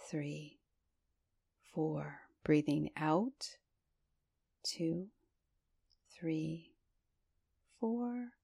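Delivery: slow counting, numbers fading to a whisper; box breathing count. Acted. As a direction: meditation guide counting four-part breaths.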